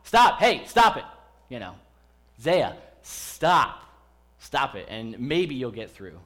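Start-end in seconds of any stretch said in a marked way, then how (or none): 0.0-1.1 much higher and louder alarmed shout; urgent yell. Retold or imitated. Parent yelling urgently at kids running toward the road. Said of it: "what are you yelling?". 2.4-4.9 put-on louder, firm calling out to a child; serious call. Parent calling a child back from the road, firm and loud. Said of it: "more serious tone".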